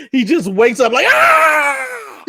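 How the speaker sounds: put-on sudden high wail of a waking baby; imitated baby cry. Dad imitating his newborn waking up, high startled cry. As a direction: mimic the baby jolting awake.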